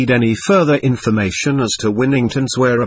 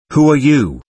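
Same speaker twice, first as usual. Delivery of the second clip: higher, slightly louder demand; raised voice; 4 semitones higher, 4 dB louder than usual. A narrator's reading. Taverner addresses the man on the bed, voice raised. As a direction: raise the voice to question firmly. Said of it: "raising his voice".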